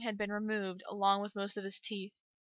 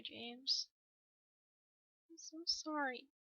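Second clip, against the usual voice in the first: soft, higher-pitched, halting grief; grieving apology; 3 semitones higher, 9 dB quieter than usual. Acted. Parent apologizing to dead son James, quiet grief. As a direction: mourn quietly, pausing between phrases.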